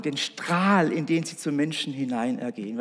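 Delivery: ordinary talk, nothing marked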